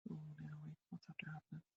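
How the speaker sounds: lost her place, whispering under her breath; a puzzled whisper while checking the page; whispered aside. Real, not acted. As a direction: whisper it to yourself, confused. Confused muttering to herself.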